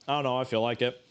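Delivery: usual speaking voice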